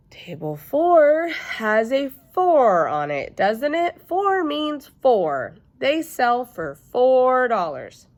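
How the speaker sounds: slow, high, loud exasperation; exasperated over-explaining. Acted. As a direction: spell it out slowly as if to a fool. Elf Ellen exasperated explaining.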